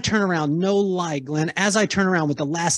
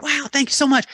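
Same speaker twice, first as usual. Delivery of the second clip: excited thanks; 10 semitones higher than usual. Retold or imitated. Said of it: "she was so excited".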